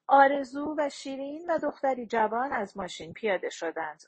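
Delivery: plain reading voice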